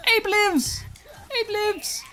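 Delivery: high, loud gleeful cries; jubilant squeal. Real, not acted. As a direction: squeal with relief and joy. Relief at surviving.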